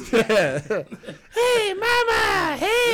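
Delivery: very high, laughing and crying; high child voice. Acted. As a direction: squeak like a crying little kid. Player voices little Marge.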